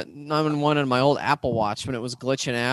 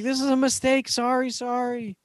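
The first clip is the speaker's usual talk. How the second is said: high, sheepish mock-panicked sorry; flustered apology; 8 semitones higher than usual. Panicked apology to 911 retold.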